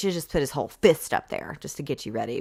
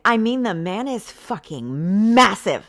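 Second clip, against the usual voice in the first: mock emphasis; 4 semitones higher, 6 dB louder than usual. Reading the heroine, loud and slow.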